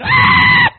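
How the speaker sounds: very high, loud mock shriek; mouse scream. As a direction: shriek like a lady seeing a mouse. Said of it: "like some of them ladies when they see a mouse".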